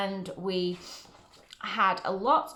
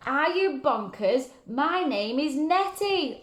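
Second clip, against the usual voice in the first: high, slow offended voice; indignant monster; 5 semitones higher, slower than usual. Acted. Monster Nettie scolding George, high slow indignant voice. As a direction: play the cross monster Nettie.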